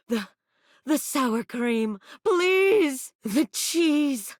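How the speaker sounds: high begging please; pleading. Acted. Pleading with the player mid-act, high plea.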